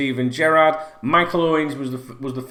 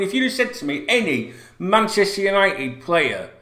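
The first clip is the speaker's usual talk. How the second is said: normal talking voice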